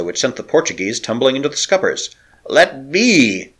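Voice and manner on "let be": cry. A narrator's reading. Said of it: "cried Huldriksen".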